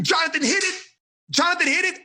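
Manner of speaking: high, bright call of praise; excited praise. Real, not acted. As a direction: cheer the right answer out. Teacher praising a right answer.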